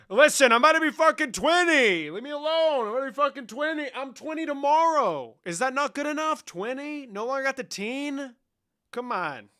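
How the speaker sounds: high, loud hammed-up indignation; mock outrage. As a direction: squeal in fake outrage about your age. Outraged at turning twenty.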